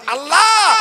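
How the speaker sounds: high, loud, drawn-out plea; crying out. Acted. Prophets appealing at Allah's court, crying out. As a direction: call up to heaven, stretching the word.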